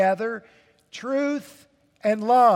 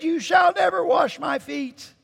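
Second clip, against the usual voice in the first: Peter protesting; 9 semitones higher than usual. Acted. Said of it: "Peter said".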